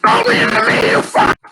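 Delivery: desperate crying shout; panicked screaming. Real, not acted. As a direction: scream no over and over, begging. Screams at character leaving him.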